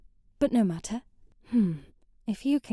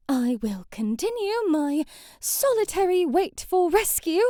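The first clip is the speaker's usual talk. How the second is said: usual speaking voice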